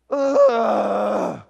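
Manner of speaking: a big exasperated ugh, let it out; loud frustrated groan. Retold or imitated. Retelling his own frustration to the crowd, loud groan. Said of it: "and I'm going".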